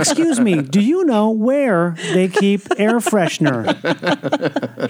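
drawn-out TV newsmagazine delivery; mock investigative reporter, stretching key words; newscaster voice. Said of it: "you talk like you're introducing a segment".

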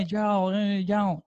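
high, put-on southern "Hey, yo" greeting; mock southern slang. Friend imitating Georgia locals' slang greeting, high and put-on. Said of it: "Allá lo usan también mucho".

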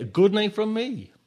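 ordinary delivery, nothing marked

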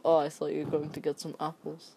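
ordinary delivery

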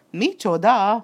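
cat snarling at the mention of books; angry growl. A narrator's reading. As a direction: huff it out in fury. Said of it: "morogta Basilio kandúr dühösen fújtatva".

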